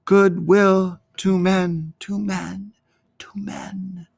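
slow, high echo fading to a whisper; voices floating away. A narrator's reading. Angel voices drift off from the shepherds, fading to whisper. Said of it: "the voices floated away".

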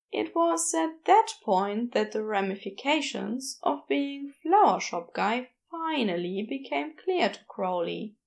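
ordinary delivery, nothing marked

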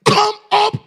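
very high, very loud ringing command; divine shout. Acted. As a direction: cry it out as the voice of God. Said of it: "The Lord is saying".

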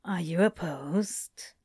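slow, whispered question; lover's whisper. A narrator's reading. Crowley at Aziraphale's neck asking consent, whispered. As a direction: whisper it slowly, teasing out the question.

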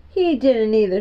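plain reading voice

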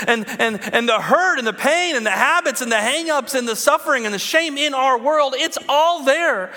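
high, loud, impassioned preaching; full-force preaching. Acted. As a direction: preach it hard to the room.

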